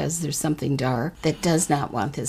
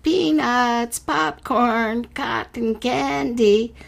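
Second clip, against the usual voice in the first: high, slow carnival barker hawking; barker shout; 8 semitones higher, much slower than usual. Acted. Carnival barker selling snacks, high sing-song call. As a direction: call it out like a fairground vendor. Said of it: "Carnival barkers shouted".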